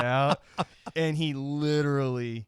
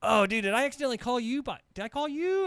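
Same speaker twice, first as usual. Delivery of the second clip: higher, fast, confused; confused surprise; 10 semitones higher than usual. Retold or imitated. Confused caller asking the manager, higher and fast. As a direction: rush out a baffled question. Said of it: "I'm like".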